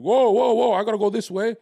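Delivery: imitating his own panicked retreat; recoiling from a creepy offer, mock alarmed; startled backing off. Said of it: "I was like".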